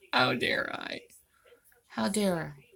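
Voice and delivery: mock-guilty whisper; hushed sarcastic how dare I. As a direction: whisper it as if caught doing wrong.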